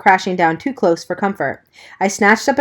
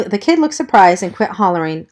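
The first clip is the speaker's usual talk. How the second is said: ordinary delivery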